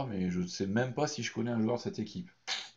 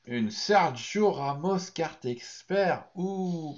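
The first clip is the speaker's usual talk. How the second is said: annonce étirée, nettement plus haute; révélation excitée; 8 semitones higher, 4 dB louder, much slower than usual. Real, not acted. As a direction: fais durer la révélation jusqu'au « ouh » aigu. Découvre une grosse carte. (découverte excitée, lente et haut perchée)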